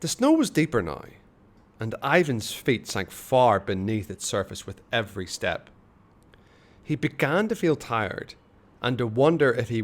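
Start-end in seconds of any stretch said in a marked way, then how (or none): none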